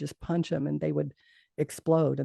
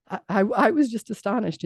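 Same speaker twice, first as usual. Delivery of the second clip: usual speaking voice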